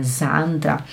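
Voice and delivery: Sandra with a Z at the start. Shown as a demonstration. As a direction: softly say "Sandra" as "Zandra". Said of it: "de Z". (Sandra com som de Z)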